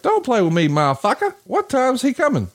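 high-pitched, worked-up mimic of his friend; imitating a friend's impatient outburst; mock angry demand. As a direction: pitch it way up like an exasperated buddy. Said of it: "He's like".

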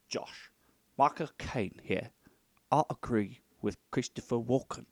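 terrible English film-star voice, measured and unhurried; Michael Caine. Acted. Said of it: "in a terrible impression of Michael Caine".

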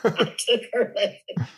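laughing. Real, not acted. Laughing at own joke.

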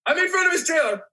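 high, panicked imitation; retold frantic protest. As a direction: imitate a kid losing his mind. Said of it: "he's losing his mind".

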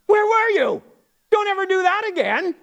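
high-pitched, hammed-up parental scolding; ticked-off parent. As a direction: scold the lost child, acting out exasperation. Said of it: "They were a little ticked".

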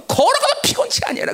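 high, sustained preaching cry; impassioned preacher reciting Scripture; fervent scripture recitation. Acted. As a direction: proclaim the verse at full pitch. Finishes the recited verse.

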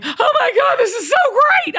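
high, loud, near-laughing cry of joy; overjoyed squeal. Retold or imitated. Mom hearing her son's good news, thrilled squeal.

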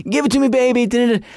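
high funk-singer calls with a grunt. Retold or imitated.

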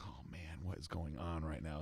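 hushed, breathy aside under the breath; shocked whisper. Retold or imitated. Coach whispering to himself at a flat client. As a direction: whisper it so nobody hears.

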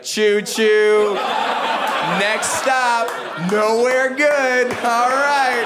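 hammed-up train announcer, drawn out; train conductor. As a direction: call out the stops like a conductor. Acts out a train conductor's call.

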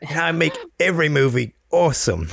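bad Jason Statham accent. Joking with co-hosts about the action star, in a bad British accent. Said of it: "That was the worst accent I've ever heard".

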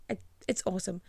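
ordinary talk

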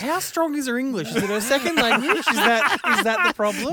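high, loud, incredulous questions through laughter; laughing tease. Real, not acted. Co-host ribbing him about his wife's English, laughing and incredulous.

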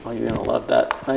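ordinary talk

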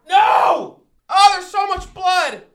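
loud, high yell of agony; agonized yell. Acted. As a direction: cry out in burning pain. Pepper agony, yelling.

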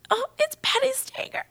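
high, hushed mock squeal; hammed-up little fangirl gasp of words; retold starstruck awe. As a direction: play starstruck in a tiny high voice. Retold starstruck reaction to caller.

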